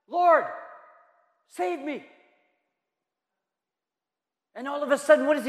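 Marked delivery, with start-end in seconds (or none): 0.1-2.1 a hint of a drowning man calling out; lightly voiced cry for help; desperate plea. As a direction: plead high, then drop off.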